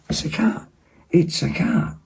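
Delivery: high cat talk. Real, not acted. Talking to the cat.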